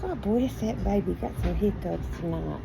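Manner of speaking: low, soft murmur of sympathy; tender. Real, not acted. Blessing a baby who has hiccups tonight, tender.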